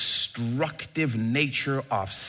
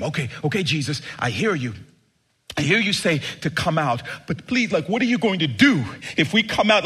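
pleading demon; 6 semitones higher, 4 dB louder than usual. Acted.

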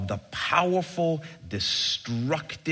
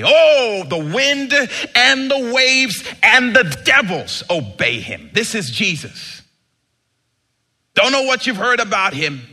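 loud, high, fervent sermon climax; preaching at full force; 9 semitones higher, 9 dB louder than usual. Acted. Pastor to his church on Christmas, full-force preaching. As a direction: lift the whole room with it.

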